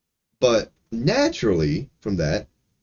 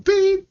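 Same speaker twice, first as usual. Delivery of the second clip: a sharp, high beep; a mock bleep, loud and high; high censor beep; 5 dB louder than usual. Censoring a streamer's slur with a high beep. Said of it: "do something".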